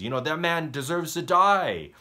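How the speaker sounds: high, loud hammed-up verdict; David condemning. David sentencing the thief, high, loud mock fury. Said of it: "David says".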